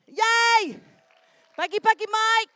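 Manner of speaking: loud, high cheering call; rally the crowd to clap; excited cheer. Real, not acted.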